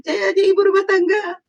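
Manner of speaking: high, whiny, sobbing imitation; mock crying. Mocking the suspect crying on TV, high mock sobbing. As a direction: parody her tearful TV plea, voice way up. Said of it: "Sampai kamu nangis-nangis itu ya".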